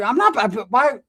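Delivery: high, loud stammering objection; flustered protest. Retold or imitated. Asked to cover half the bill, flustered high protest. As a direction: sputter it, voice jumping up.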